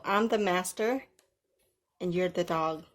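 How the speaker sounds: ordinary talk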